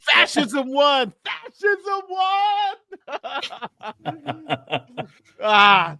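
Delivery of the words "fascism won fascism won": slow, hammed-up loud lament, pitched way up; mock-despairing howl at the news; mock wail. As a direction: drag it out like a tragic cry.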